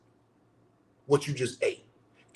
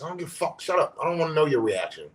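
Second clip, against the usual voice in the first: ordinary talk, nothing marked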